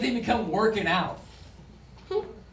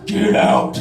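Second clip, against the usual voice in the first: ghostly demand; 10 dB louder than usual. Acted. Ghostly wall message.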